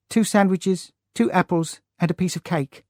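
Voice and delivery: a young girl reciting a list as if to a teacher, lightly voiced; flat school recital. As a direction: list it off dutifully, like a pupil answering. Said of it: "in the same flat voice as she used at school".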